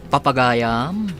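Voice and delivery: ordinary talk, nothing marked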